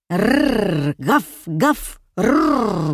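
rolled growl and loud "gaf" barks; menacing dog barking. A narrator's reading. Dogs barking somewhere behind houses, menacing growls and barks. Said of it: "грозно заливались собаки".

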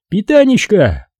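high-pitched, exasperated exclamation; exclaiming commissar. Acted. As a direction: throw the word out high, as an outburst. Commissar ends his agitated outburst. (высокий возглас возбуждённого комиссара)

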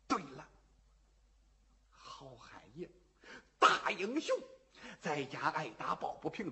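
put-on very soft, puzzled old man thinking aloud under his breath; quiet muttering old villager; old man muttering to himself.

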